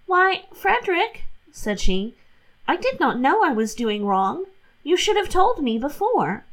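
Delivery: lightly voiced guileless young wife; Catherine's innocent voice. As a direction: rattle off the excuses innocently.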